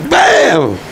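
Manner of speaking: cartoon bang yelled out; mock impact shout. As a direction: shout it like the sound of the door hitting you. Acting out hitting his head.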